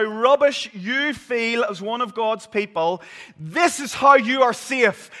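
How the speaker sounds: loud, high, driving sermon voice; thundering pulpit preaching; preaching at full force. Acted. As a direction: preach it out to the congregation. Preaches assurance to congregation.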